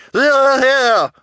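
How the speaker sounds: extremely high, loud squeal of assent; townswoman's cry. A narrator's reading. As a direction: shriek agreement as a townswoman. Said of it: "Missus Morrow cried".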